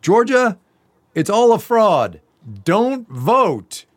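high, loud rallying cry; hammed-up urgent proclamation; mock alarmist. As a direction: sound the alarm like an overwrought campaigner. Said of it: "some other attorneys have been saying".

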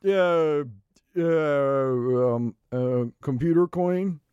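halting dude-bro, dropping low on drawn-out uhs; floundering. Acted. Host bluffing what crypto is, floundering and hesitant. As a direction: stall and grope for an answer.

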